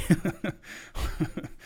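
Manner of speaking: a short unvoiced chuckle at himself; soft airy giggle; breathy laugh. Real, not acted. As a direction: laugh off the contradiction. Laughs at his own contradiction.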